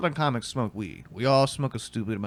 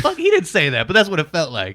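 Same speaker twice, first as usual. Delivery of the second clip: much higher, louder indignant outburst; worked up; 7 semitones higher, 10 dB louder than usual. Real, not acted. A comic complaining about being carded at a bar, loud and worked up. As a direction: vent the outrage at the bouncer.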